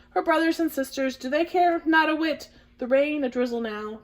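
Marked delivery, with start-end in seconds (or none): none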